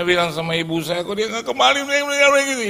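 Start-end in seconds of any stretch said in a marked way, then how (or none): 1.1-2.6 much higher crying complaint; whiny lament. Retold or imitated. Son complains to mother, high whiny crying. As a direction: whine to mama.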